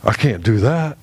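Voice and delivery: mocking a proud father's refusal; proud whiny excuse. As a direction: sulk it out like a stubborn father refusing.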